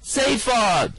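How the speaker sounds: high loud call down a hole. Acted. Calling down to Zaphod in the hole. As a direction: call his name down the pit.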